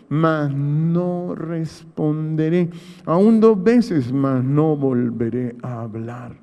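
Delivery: drawn-out, each phrase weighed slowly; slow solemn scripture quote. Retold or imitated. Preacher reading Job's vow to the congregation, slow and solemn. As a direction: read Job's vow slowly, like a sacred promise. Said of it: "Cinco dice".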